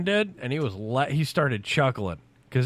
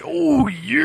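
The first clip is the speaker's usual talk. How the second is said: high, stretched wrestler catchphrase; Randy Savage impression; 11 semitones higher, 4 dB louder than usual. Storyteller throwing out the wrestler's catchphrase, Randy Savage impression. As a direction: put on a big showman's holler. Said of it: "Or should I say".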